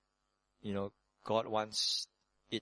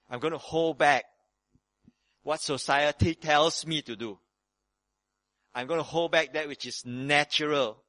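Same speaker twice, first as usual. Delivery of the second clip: slow, loud, higher preaching of resolve; forceful preaching; 5 semitones higher, 7 dB louder, slower than usual. Acted. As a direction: preach it hard, pulling each phrase out. Preacher building to a forceful declaration.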